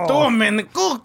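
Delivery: put-on hammy French accent; mock French chef voice; fake French accent. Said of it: "French person".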